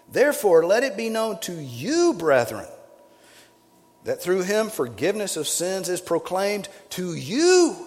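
loud, high, proclaiming the verse; declaimed scripture. Acted. Preacher reading Paul's words aloud to the brethren, declaiming loudly. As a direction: ring out the apostle's words.

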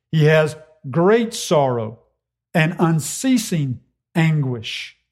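great and unceasing leaned on slowly; stress on great and unceasing. Shown as a demonstration. Preacher contrasting plain sorrow, slow stress. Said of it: "it's not that he just has sorrow".

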